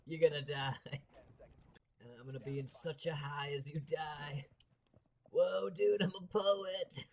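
loud spaced-out stoner dude. Acted. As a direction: ham up a blissed-out stoner. Voicing stoned Smoke in smoke.

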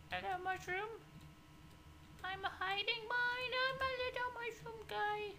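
very high squeaky cartoon voice; tiny squeaky voice. Tiny voice for mushroom.

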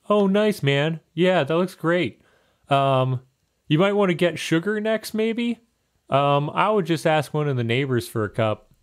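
high, bright bird shopkeeper voice; chirpy helpful baker talking up high; Macadamia voiced. Bird baker to the player, high eager helper voice. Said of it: "He's like".

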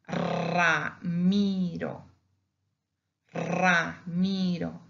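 Ramiro very slowly, first R long and trilled; double R at start. Shown as a demonstration. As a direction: roll the opening R of Ramiro, drawing it out. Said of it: "we pronounce it as double".

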